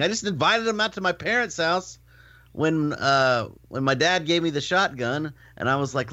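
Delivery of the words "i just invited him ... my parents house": usual speaking voice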